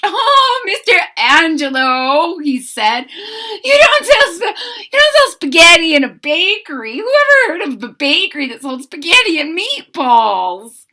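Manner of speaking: put-on high, loud laughing boy; giggling high boy's voice. A narrator's reading. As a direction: laugh through the words. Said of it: "Jim laughed and laughed".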